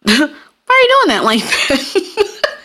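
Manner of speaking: high, slow, laughing fake-sweet question; sweetly questioning a coworker's work; sing-song question. Imitating herself quizzing a coworker, high and laughing. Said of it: "I'll be like".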